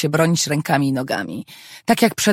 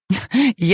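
a brief amused chuckle; short laugh; 4 semitones higher than usual. Acted. Father laughs at son already planning his master's thesis, light chuckle. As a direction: laugh at the son's eagerness. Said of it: "roześmiał się Jarek".

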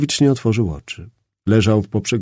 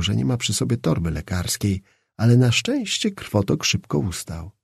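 ordinary delivery, nothing marked

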